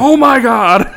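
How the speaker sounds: high, loud startled outburst; mock panicked cry. Nature host as a rhino escapes, mock panicked cry. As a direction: break from narration into mock alarm. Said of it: "baby rhino runs through the bars".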